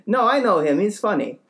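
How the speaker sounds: lightly voiced neighbor covering; defensive neighbor. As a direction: brush off the question. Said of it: "and he goes".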